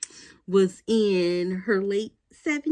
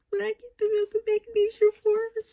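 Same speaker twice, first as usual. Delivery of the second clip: emotional. Real, not acted. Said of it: "I don't know why I'm getting emotional".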